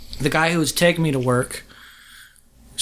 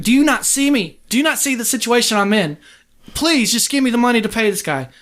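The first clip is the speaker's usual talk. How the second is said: desperate pleading; 7 semitones higher than usual. Retold or imitated. Beaten man begs hotel clerk for cash, high and pleading. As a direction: beg the clerk, voice pitched up and urgent. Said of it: "after begging him".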